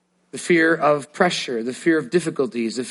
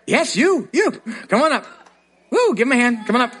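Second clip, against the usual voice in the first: calling out; 7 semitones higher than usual. Real, not acted. Calling a volunteer up.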